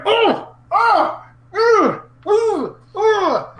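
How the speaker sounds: high, loud strangled oh-oh yelps; King's weird noises. Retold or imitated. Co-host mocking King's commentary noises, high loud yelps. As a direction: yelp each oh way up high like a cartoon. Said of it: "You don't need to make weird".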